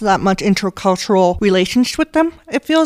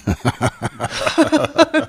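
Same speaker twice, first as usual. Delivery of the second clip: short laugh; 6 semitones higher than usual. Real, not acted. Laughs at being hunted down.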